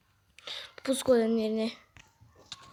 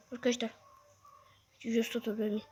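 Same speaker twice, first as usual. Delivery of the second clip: ordinary talk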